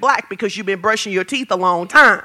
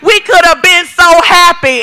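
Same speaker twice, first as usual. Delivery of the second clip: mock lovesick sigh; 5 semitones higher, 6 dB louder than usual. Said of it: "now you like".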